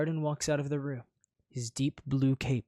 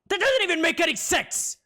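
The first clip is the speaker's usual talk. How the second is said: offended outburst; 8 dB louder than usual. Acted. Offended prince.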